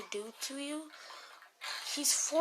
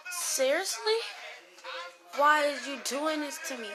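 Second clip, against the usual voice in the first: exasperated disbelief; 6 semitones higher than usual. Retold or imitated. Girl wakes up to blood and cries out, high and disbelieving. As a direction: throw it up to the ceiling in disbelief. Waking up to blood on her hand.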